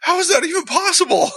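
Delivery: very high, incredulous squeal, acted out; retold disbelief. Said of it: "I was like".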